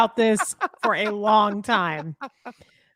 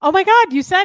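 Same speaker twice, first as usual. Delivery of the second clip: loud, high delighted cry; excited; 8 semitones higher, 8 dB louder than usual. Real, not acted. Cheering her friend's right Topeka. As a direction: cheer that he finally got it.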